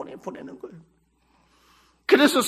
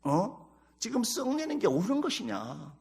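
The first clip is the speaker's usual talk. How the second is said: low-key, softer questioning reproof; lightly voiced God, quietly taking someone to task; God rebuking Jonah; 6 semitones lower, 7 dB quieter than usual. God questioning the angry prophet, quiet reproof. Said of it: "하나님께 이 요나가 막 썩내고 욕하는 것을 보고".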